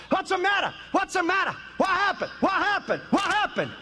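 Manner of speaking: jolted awake and frightened, asking again and again; panicked questions. Retold or imitated. The proud businessman waking on the shaking plane, panicked.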